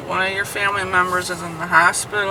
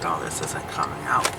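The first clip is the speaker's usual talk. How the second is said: hushed retold aside; secretive whispered murmur; 6 semitones lower, 11 dB quieter than usual. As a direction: drop to a whisper, as if not to be overheard. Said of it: "And she's like".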